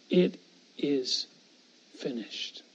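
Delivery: low, soft and hushed, as if dying; hushed last words. Acted. Preacher voicing Jesus's final words on the cross, low and hushed. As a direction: let the words sink low and quiet.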